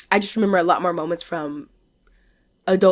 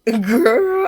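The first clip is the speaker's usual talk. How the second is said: mock warning; 5 semitones higher, 6 dB louder than usual. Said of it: "and I went".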